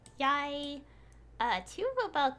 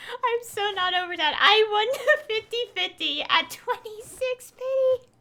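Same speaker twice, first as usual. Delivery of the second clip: gushing, higher and louder; excited; 8 semitones higher, 8 dB louder than usual. Real, not acted. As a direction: still buzzing over the win. Still gushing about the lucky pull.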